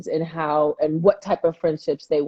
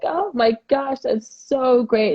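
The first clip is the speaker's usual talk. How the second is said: high, slow mock-gushing oh my gosh; gushing teacher; 6 semitones higher, slower than usual. Mom imitating the teacher won over by pierced ears, high gushing. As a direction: play the art teacher charmed and delighted. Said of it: "And she was like".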